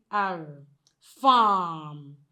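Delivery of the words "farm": "farm" drawn out on the middle "ah"; long vowel. Shown as a demonstration. Teacher shows sound in middle of word, long vowel. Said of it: "in the middle of the word".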